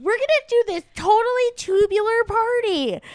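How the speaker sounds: put-on high, excited Valley Girl accent; bubbly Valley Girl party talk. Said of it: "a Valley Girl accent".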